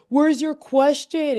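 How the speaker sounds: high, slow mocking question. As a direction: drag it out in a high voice. Mock voicing town hall host.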